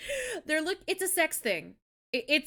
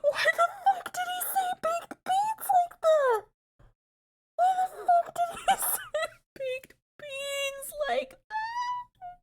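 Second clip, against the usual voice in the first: squeaky laughing fit; crying with laughter; laughing hard; slower than usual. Real, not acted.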